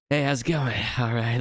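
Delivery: silly put-on greeting voice; weird put-on voice. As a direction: put on a daft voice for fun. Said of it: "like, weird, like".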